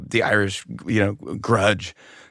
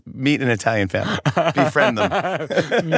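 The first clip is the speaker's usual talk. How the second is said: mock cookbook; 4 semitones higher than usual. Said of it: "first page says".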